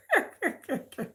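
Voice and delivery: quiet breathy chuckle; soft laugh. Real, not acted. After joking to viewers, soft laugh. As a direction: let a soft laugh slip.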